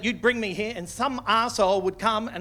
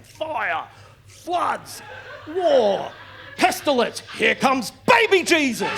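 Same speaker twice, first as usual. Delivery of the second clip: mock-ecstatic preacher building to a shout; gleeful evangelist; 4 semitones higher, slower than usual. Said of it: "they're watching this shit going".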